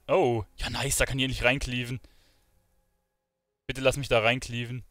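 usual speaking voice

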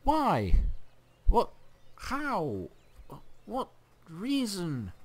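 very high, rising disbelief; incredulous outburst. Real, not acted. Gamer betrayed by an ally, high-pitched incredulous questions. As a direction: squeak each question in utter bafflement. Stunned by surprise war declaration.

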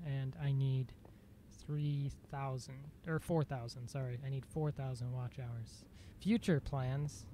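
normal talking voice

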